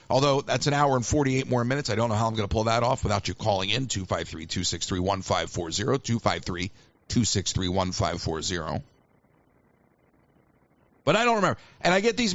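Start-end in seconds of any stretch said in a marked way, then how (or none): 3.9-8.8 low, softer announcer read of the number; phone number read. Acted. Host giving listeners the call-in number in announcer voice. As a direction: read the number like a station promo.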